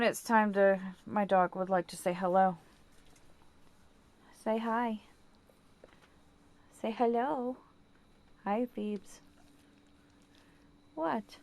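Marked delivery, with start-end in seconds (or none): 4.4-11.4 lightly raised, sweet pet voice; talking to the dog. Real, not acted. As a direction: coax the dog softly, a bit higher.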